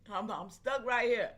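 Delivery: ordinary talk, nothing marked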